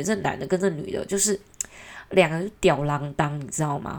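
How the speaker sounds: ordinary talk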